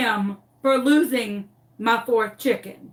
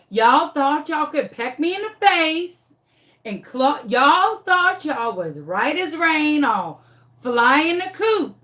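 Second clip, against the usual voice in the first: sassy dog voice; 5 semitones higher, 3 dB louder than usual. Retold or imitated. Traumatized dog to the chickens who pecked her, taunting. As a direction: taunt them as a wronged dog. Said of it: "because she was like".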